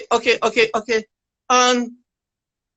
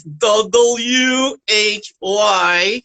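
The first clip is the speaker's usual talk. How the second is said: spelling out; much slower than usual. Shown as a demonstration. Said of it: "I even spell it kind of weird".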